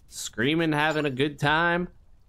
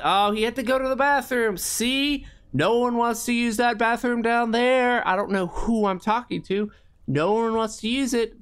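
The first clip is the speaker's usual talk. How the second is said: higher, louder chatty rambling; excited rant; 8 semitones higher, 3 dB louder than usual. Real, not acted. Window cleaner chattering loudly to nobody. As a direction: talk it up to an empty room.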